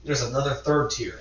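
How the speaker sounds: usual speaking voice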